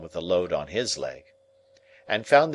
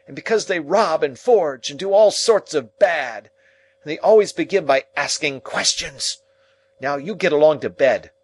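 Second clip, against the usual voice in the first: much higher, louder berating; angry tirade; 10 semitones higher, 8 dB louder than usual. Acted. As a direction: berate him and send him off.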